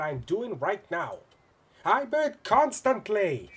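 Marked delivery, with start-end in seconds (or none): 1.8-3.5 loud, high, slow insistence; indignant villain. Acted. Villain insists to the hero he bids, loud and indignant. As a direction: declare it with hammy pride.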